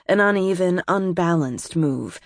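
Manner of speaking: plain reading voice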